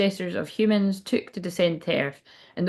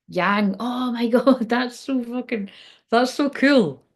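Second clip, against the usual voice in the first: higher, drawn-out delighted awe; real amazement; 4 semitones higher, slower than usual. Real, not acted. Reader thrilled by what just came out, amazed. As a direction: let it rise, slowly savouring the surprise.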